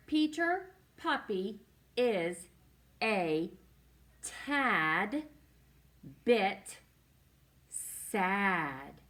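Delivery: slow, with a pause after each word; deliberate beginner-reader pacing, every word separate; word-by-word reading. Shown as a demonstration. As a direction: read it out one stretched word at a time.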